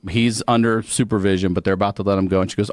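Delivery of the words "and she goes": usual speaking voice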